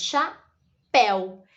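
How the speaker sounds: "chapéu" in two slow beats, cha, then péu; syllable division. Shown as a demonstration. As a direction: break "chapéu" apart with a pause after cha. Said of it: "ela só tem duas sílabas". ("chapéu" separado em sílabas, devagar)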